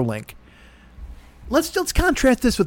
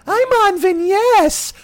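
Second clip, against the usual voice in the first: very slow, high singsong mockery; drawn-out high mock announcement; mocking imitation; 12 semitones higher, much slower than usual. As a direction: stretch it out in a high, silly voice. Mocking friend's text.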